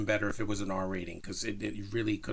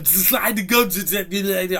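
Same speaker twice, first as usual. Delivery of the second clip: loud, high mock-German villain line, drawn out; garbled foreign villain accent; 9 semitones higher, 10 dB louder, much slower than usual. Reviewer mocking a film villain's line, garbled foreign accent. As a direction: imitate an actor's unplaceable Spanish-German accent, pitched up and loud. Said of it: "what, what is that accent".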